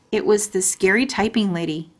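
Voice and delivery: ordinary delivery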